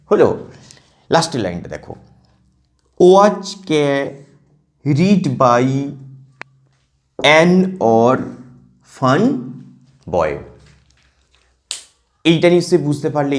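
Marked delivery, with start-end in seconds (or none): 3.0-10.3 very slow, broken into metrical feet; halting, two-syllable verse chunks; reading in pairs. Shown as a demonstration. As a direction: split words across long pauses. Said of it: "দুটো দুটোকে নিয়ে জোর করবে".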